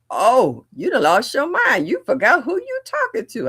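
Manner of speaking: mother laying down the law, rising high; indignant acted mama telling-off; angry mother. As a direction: scold a mouthy kid, pitch climbing. Acting mother confronting mouthy daughter.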